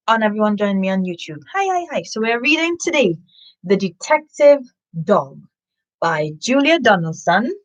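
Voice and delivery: plain reading voice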